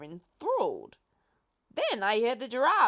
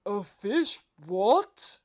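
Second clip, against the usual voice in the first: puzzled pelican voice; 7 dB louder, much slower than usual. A narrator's reading. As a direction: ask it like a baffled bird. Pelican and boy dialogue.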